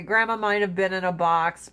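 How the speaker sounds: ordinary talk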